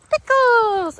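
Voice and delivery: high, loud, drawn-out call; calling out to dog. Real, not acted. Trainer recalling her dog, calling out. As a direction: call the name across a field.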